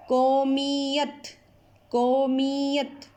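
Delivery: قومیت drawn out slowly and clearly; slow model word. Shown as a demonstration. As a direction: present the word slowly to pupils. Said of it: "کاف پیش کو".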